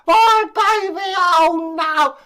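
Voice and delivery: high, loud anguished wail; hammed-up shriek of horror; wailing queen. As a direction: cry out in mock despair. Queen wails over baby.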